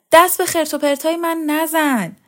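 high, loud angry order; shouting. A narrator's reading. Mother to daughter rummaging in her things, angry shout. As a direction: yell it as a sharp warning. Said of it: "خانم ادریسی فریاد زد".

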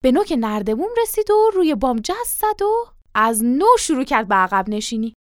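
plain reading voice

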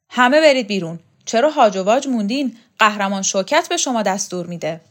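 imperious, forceful bellow; commanding shout. Acted. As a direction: order them all out with full authority. Heroine orders everyone out.

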